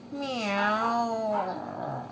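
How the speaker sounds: long, falling creaky meow; Gary the snail. Acted. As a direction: meow like a cartoon pet snail. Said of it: "said Gary".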